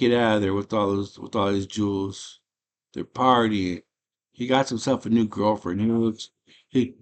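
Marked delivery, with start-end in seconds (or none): none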